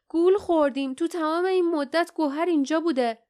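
high, worked-up realization; agitated exclamation. A narrator's reading. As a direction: burst out with the discovery. Character exclaims a discovery amid danger.

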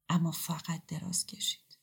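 soft whispered aside; whispered narration. A narrator's reading. Narrator tells quietly how he just lay down, whispered. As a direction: drop to a whisper on "اما فقط دراز کشید". Narration after suppressed urge to shout.

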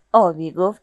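ordinary delivery, nothing marked

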